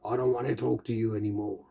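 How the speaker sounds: quick, curt mock rudeness; rude voice. Someone cutting off the conversation, fast mock rude. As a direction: toss it off fast like a rude stranger. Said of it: "they say it in such a rude voice".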